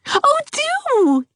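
put-on high, keen little girl; a child eager to agree; high, eager girl's voice. A narrator's reading. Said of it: "said Geraldine".

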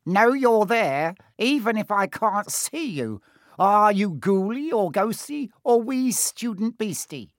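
high, sing-song menacing imp; mischievous poltergeist. Acted. Peeves hunts unseen students on the stairs, high taunting imp voice. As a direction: taunt hidden prey in a high, drawn-out voice.

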